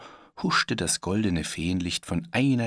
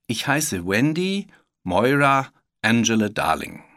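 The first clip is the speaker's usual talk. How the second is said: put-on proudly announced girl's name, louder and higher; loud, proud little-girl introduction; 4 semitones higher, 6 dB louder than usual. A narrator's reading. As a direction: present the full name with pride. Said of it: "sagte das Mädchen stolz".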